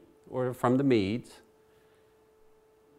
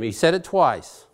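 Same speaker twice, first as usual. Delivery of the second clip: ordinary delivery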